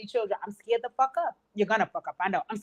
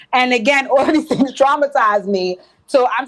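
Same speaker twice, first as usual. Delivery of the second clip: laughing loudly through the words; big laugh while speaking; 2 semitones higher, 13 dB louder than usual. Real, not acted. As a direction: laugh it out, much louder. Laughing loudly about trauma.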